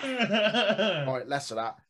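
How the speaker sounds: ordinary delivery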